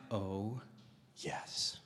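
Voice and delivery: low, very hushed scheming delight; gleeful sneaky whisper. Retold or imitated. Husband spying on his wife before a prank, gleeful whisper. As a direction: savor it under your breath.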